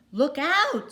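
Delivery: high, loud panicked squawk; alarmed squawk. Acted. Missus Mallard warning her husband of traffic, alarmed squawk. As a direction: shriek a warning in a dither. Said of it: "squawked Missus Mallard, all of a dither".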